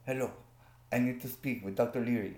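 badly faked French accent; horrible French accent. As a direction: put on a hammy, terrible French accent. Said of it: "It was a horrible, horrible, horrible, uh, French accent".

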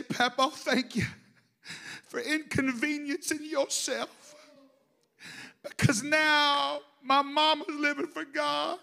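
crying, high, slow and strained; tearful thanks. Real, not acted. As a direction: choke up as you thank him. Tearful thanks to grandfather in heaven.

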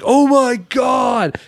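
high, drawn-out exclamation of horror; acted-out alarm, stretched and high-pitched; mock horrified cry. Acting out the horrified onlooker's cry.

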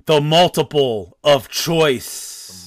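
slow, drawn-out round title; grand announcement. Pretend. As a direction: announce it like a game-show host. Host announces quiz round title.

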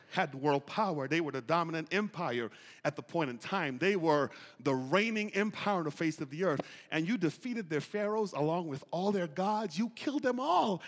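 ordinary talk

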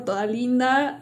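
usual speaking voice

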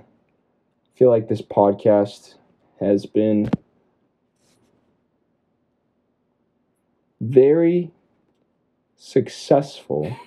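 slow, with long halting pauses; really slow. Real, not acted. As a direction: drag it out to fill time. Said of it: "really slow".

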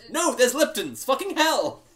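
exasperated shout. Real, not acted. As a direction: snap it out, fed up. DM exasperated at drink questions.

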